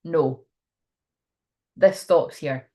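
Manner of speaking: low, firm mock anger; angry boundary-setting. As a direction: draw the line, with a pause after the refusal. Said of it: "getting really angry and being like".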